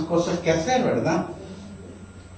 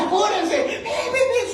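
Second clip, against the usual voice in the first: calling out; slower than usual. Retold or imitated. Head out window, yelling at traffic.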